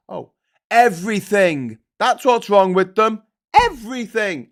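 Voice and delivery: loud, slow, drawn-out everything; emphatic everything. Real, not acted. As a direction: hammer home the word everything. Emphatic answer, what's wrong.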